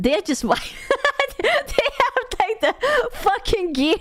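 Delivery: cracking up, voice pitched high; laughing. Real, not acted. Player mocking the nerds in their gear, laughing hard. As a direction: squeal the words out through laughter.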